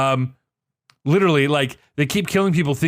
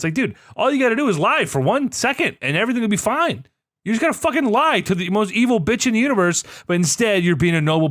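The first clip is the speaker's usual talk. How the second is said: exasperated; 7 semitones higher than usual. Real, not acted. Exasperated at Jon's nobility.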